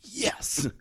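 high-pitched retold yes of triumph; excited. Player told by coach he will start, excited high cheer. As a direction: relive the thrill, voice leaping up. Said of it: "so excited".

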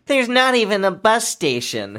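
put-on high, loud smug child voice; smug little kid. Mocking the movie's kid correcting the adults, smug. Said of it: "that little smug shit goes".

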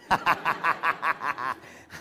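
a real laugh; hearty laugh. Real, not acted. Pastor laughing at his own piglet story, real laugh.

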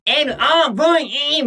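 high, piercing pop-idol demo. Shown as a demonstration. Director demonstrates the wanted voice to the singer, high-pitched. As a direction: show a high, cutesy squeal of a chant. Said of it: "[name]みたいな。".

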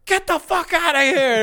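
high, loud furious order; angry manager yell. Retold or imitated. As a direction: throw them out with a yell. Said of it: "he's like".

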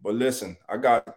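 ordinary talk

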